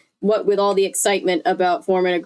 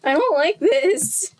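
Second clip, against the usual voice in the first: whiny complaint; 8 semitones higher, slower than usual. Retold or imitated. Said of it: "And I'm just like".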